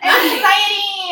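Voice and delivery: high, loud, drawn-out mock wail; mock panic. Imitating shaking boyfriend in the car, mock panic. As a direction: stretch it out in fake panic.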